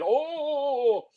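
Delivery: high squeaky yelp; a silly squeal of fright; mock yelp. Picturing Connery with a high yelp. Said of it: "Connery going".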